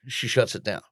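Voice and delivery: ordinary talk, nothing marked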